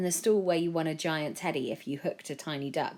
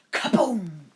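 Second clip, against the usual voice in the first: explosion; 3 semitones higher, 7 dB louder than usual. A narrator's reading. Castle explodes.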